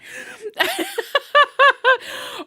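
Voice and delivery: very high, loud laugh; delighted laugh. Real, not acted. Reader spots Christina in the live chat, high loud laugh. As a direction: laugh with glee.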